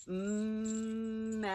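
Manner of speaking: "Mess" held long on every sound; said slowly. Shown as a demonstration. Teacher counting sounds with helpers, very drawn-out. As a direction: hum the m of "Mess", then draw out the rest. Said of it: "Say it slowly".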